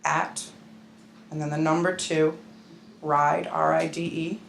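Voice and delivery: slow, spaced out for someone writing it down; slow dictation. Shown as a demonstration. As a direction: dictate the address piece by piece, with long pauses. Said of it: "Say it slow".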